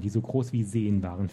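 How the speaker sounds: ordinary delivery, nothing marked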